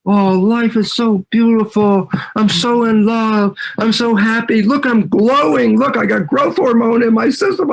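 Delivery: high, gushing rapture; giddy newly-in-love gush, hammed up; gushing lovestruck. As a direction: swoon over new love with the voice lifted high.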